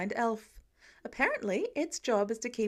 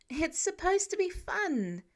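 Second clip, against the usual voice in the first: insisting; 7 semitones higher than usual. A narrator's reading. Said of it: "Peter insists".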